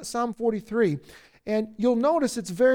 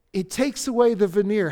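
ordinary talk

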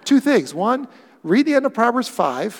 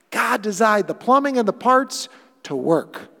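ordinary talk, nothing marked

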